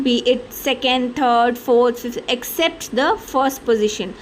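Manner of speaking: normal talking voice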